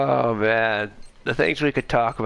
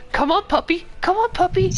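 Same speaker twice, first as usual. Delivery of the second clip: high, mocking call to a dog; sing-song taunting a puppy, pitched way up; Dante taunt. Gamer quoting Dante taunting the boss, high mocking call.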